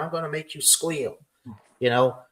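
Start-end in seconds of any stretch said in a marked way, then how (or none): none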